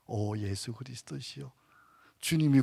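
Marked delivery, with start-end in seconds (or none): 0.1-1.5 오 예수 그리스도시여 said low and hushed; soft repeat. Shown as a demonstration. Preacher repeats the name of Jesus in a hush. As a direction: breathe the prayer again softly.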